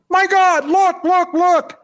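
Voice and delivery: high-pitched, urgent imitated yell; retold yelling. Hunter yelling to friends behind him on the hill, retold yelling. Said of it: "he started yelling".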